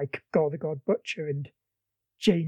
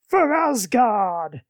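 drawn-out rallying cry, somewhat louder; mock battle yell; 3 semitones higher, 5 dB louder, much slower than usual. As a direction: proclaim it slowly like a god going to war. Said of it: "Odin's next to him yelling".

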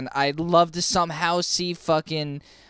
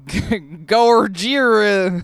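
southern twang; 4 semitones higher, 6 dB louder, much slower than usual. Shown as a demonstration. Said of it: "with, like, a southern twang".

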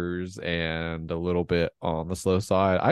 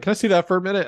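mock asking; 13 semitones higher, 7 dB louder, much faster than usual. Retelling guy asking for a nip.

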